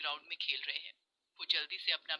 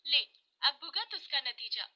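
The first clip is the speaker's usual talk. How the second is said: higher, gloating schoolgirl bully; vindictive taunt; 6 semitones higher than usual. Acted. Neha taunts Kosi through the storeroom door, gloating. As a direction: rub it in spitefully.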